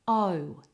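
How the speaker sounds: O said as a glide from oh into oo; two-part vowel. Shown as a demonstration. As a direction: round the O off toward a W. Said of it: "It has two mouth positions".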